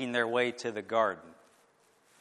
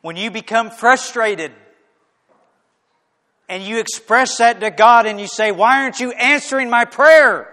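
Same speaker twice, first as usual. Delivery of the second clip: 1 fervent preaching; 10 semitones higher, 16 dB louder than usual. Acted. Preaching climax.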